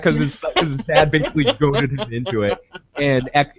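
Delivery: normal talking voice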